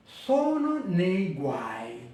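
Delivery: Sono nei guai slowly, uai one syllable; slow teacher Italian; slow Italian sentence. Shown as a demonstration. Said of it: "vous avez les mots avec des triphtongues".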